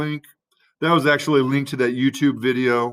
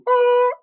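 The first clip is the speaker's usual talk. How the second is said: very high, sustained "ah" sound; mock sun-charging "ah"; 4 dB louder than usual. Teacher jokes about soaking up sunlight for energy, high mock "ah". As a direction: imitate charging up with one high held tone.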